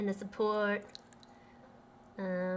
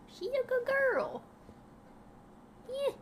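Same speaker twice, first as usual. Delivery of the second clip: much higher dog-praise voice; high sing-song praise; baby talk; 12 semitones higher than usual. Acted.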